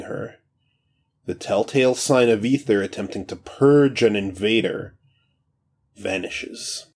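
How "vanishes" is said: ordinary delivery, nothing marked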